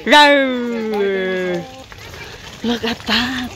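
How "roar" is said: drawn-out low dino roar; dinosaur voice. Shown as a demonstration. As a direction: growl it out like a dinosaur. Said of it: "show your teeth like dino".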